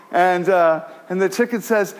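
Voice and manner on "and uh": normal talking voice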